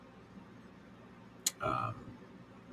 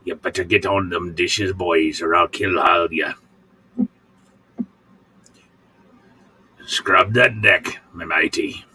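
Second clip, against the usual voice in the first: pirate voice. Retold or imitated. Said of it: "He likes my pirate voice".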